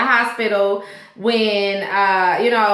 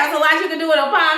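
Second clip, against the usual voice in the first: fast, higher sarcastic parroting; mocking repeat; 5 semitones higher, much faster than usual. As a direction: throw his words back, ridiculing them. Mocking Pete's line back.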